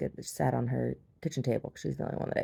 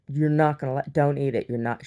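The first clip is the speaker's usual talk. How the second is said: louder, insistent mock scolding; angry mom; 6 dB louder than usual. Mom warning child off the egg, mock angry. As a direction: warn sharply as an angry mother would. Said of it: "she's, like, angry".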